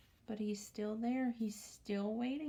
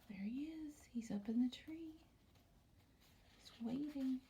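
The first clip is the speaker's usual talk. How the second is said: hushed, excited whisper; 3 semitones higher, 4 dB quieter than usual. Pretend. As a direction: whisper the discovery to the kids.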